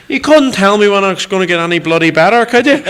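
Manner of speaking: a hint of a cheeky complaining woman; grumbling woman. Woman asks in chip shop.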